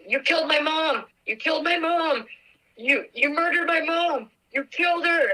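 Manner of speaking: high-pitched, raw accusing cry; anguished accusation. Retold or imitated. Retelling calling her mom's boyfriend, high anguished accusation. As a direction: hurl the blame at him, voice up high.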